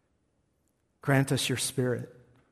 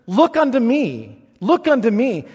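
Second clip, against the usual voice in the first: preached call; 11 semitones higher, 12 dB louder than usual. Acted. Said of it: "I remember how he said it".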